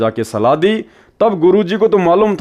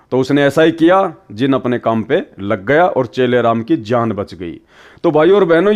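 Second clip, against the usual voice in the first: usual speaking voice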